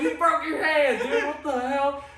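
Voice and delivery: high, laughing mock disbelief; shocked disbelief. Teammate staring at the broken hand, high laughing disbelief.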